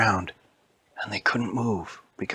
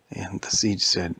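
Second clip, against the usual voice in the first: plain reading voice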